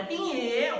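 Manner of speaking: 学小孩子高声叫爷爷; 像孩子老远跑来开心地喊; 小孩喊人. Retold or imitated. 孩子远远跑来叫他. (学孩子高声喊爷爷)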